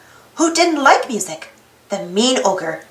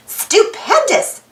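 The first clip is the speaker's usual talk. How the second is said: slow, high, drawn-out exclamation; stretched exclamation; 11 semitones higher, much slower than usual. Acted. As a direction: savour the big word with delight. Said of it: "exclaimed June".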